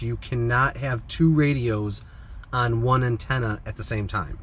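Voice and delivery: usual speaking voice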